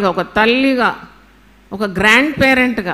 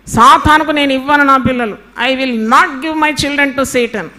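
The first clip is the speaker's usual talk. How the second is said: put-on loud, pleading cry to God over her children; mother crying out to God to claim her children, fervent and loud; fervent prayer; 3 semitones higher, 5 dB louder than usual. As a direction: pray it out loud like a desperate mother. Preacher voices a fervent parent prayer.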